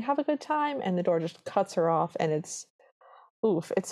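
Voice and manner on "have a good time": a small hopeful goodbye, retold; calling Cinderella. Cinderella retold saying goodbye as door shuts, lightly voiced and higher. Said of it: "She's just saying".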